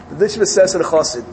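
ordinary talk

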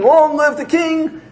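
high, drawn-out chant of acclaim; mock scream. Excited boy cheering for the coming king, mock scream. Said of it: "he starts screaming".

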